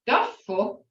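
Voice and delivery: duffel said slowly with a falling tune; Muskogee word. Shown as a demonstration. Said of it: "I have no clue what it is in English".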